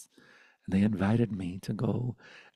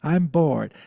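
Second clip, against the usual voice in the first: put-on loud, fast, high childish complaint; protesting kid; 5 semitones higher, 8 dB louder than usual. Confused child protesting to grandma, loud and fast. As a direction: blurt it like an exasperated kid.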